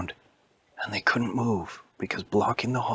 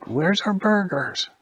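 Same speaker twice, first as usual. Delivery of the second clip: impatient customer; 11 semitones higher, 4 dB louder, slower than usual. Acted.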